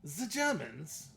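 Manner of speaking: high, put-on movie tough-talk quote; acted-out Snatch line; movie character impression. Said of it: "he goes".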